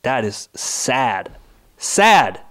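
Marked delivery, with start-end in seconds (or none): 1.8-2.4 sudden loud, high outburst on Sad; angry shout. Real, not acted. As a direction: blurt it out in real anger. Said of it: "I'm getting angry".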